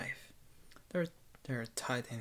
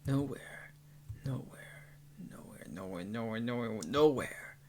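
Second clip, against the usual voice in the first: stunned disbelief; 2 semitones lower than usual. A narrator's reading. Narrator finds no lines on the body, stunned building repetition. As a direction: start almost under the breath, repeat faster and climb.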